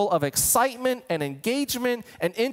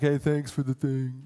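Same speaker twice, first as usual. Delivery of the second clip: lukewarm thanks; 5 semitones lower than usual. Shown as a demonstration. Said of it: "And I don't mean like".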